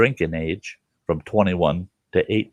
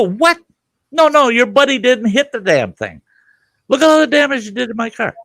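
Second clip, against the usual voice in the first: high, loud outraged objection; indignant protest; 4 dB louder than usual. Retold or imitated. To the cop claiming the deer, a loud indignant protest.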